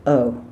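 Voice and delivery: "Oh" from the throat, jaw loose. Shown as a demonstration. Coach modelling a throaty oh. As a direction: say "Oh" with relaxed jaw and lips. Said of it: "relax your jaw and lips and say".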